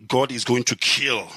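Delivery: usual speaking voice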